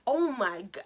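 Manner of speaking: ordinary talk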